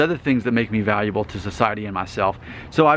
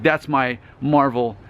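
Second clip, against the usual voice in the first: ordinary delivery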